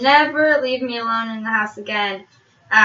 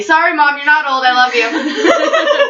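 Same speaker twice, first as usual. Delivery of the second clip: loud laughing apology to mom; 4 semitones higher, 6 dB louder than usual. Real, not acted. Apologizing to mom watching channel.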